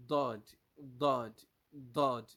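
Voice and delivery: "Dod" with the tongue's sides touching the teeth; punched, separate repetitions of emphatic "Dod"; Arabic Daad demo. Shown as a demonstration. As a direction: model "Dod" firmly with a heavy DH-like D. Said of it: "You are going to pronounce it".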